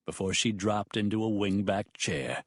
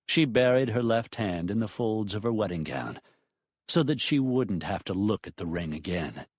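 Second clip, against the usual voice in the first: plain reading voice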